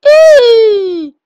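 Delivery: sharp high squeal, loud. Real, not acted. Player squeals as a bigger cell chases him, high loud squeal. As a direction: shriek of a cornered cell.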